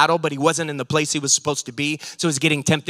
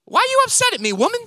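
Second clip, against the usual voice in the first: much higher, mock-indignant boy's reply; young Jesus answering Mary; 5 dB louder, faster than usual. Twelve-year-old Jesus talking back to his mother, high and indignant. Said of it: "And he goes".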